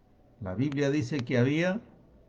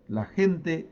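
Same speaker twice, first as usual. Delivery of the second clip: normal talking voice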